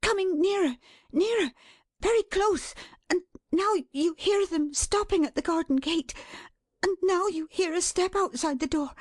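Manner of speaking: high, urgent anticipation; panted, mounting excitement. A narrator's reading. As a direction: count down each sound as it nears. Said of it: "she panted".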